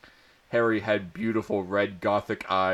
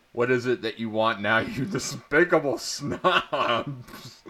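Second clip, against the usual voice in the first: angry; 2 semitones higher than usual. A narrator's reading. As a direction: sneer at the pestering kids. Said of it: "he asked angrily".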